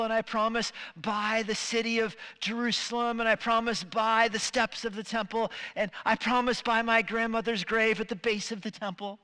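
oversell it like a shady investor; pushy salesman. Acted. Investor swearing oaths to a doubtful client, high and pleading.

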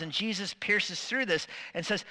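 ordinary talk, nothing marked

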